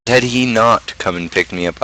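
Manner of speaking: usual speaking voice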